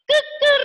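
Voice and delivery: high crowing cuckoo call; rooster crow. Shown as a demonstration. Teacher crows like a rooster for the class. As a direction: crow like a cock. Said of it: "Should I make the sound again?".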